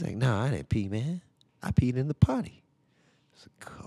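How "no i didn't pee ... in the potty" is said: put-on higher kid voice, insistent; son's indignant denial. Son protesting to dad that he used the potty. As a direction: play the little boy swearing he's innocent.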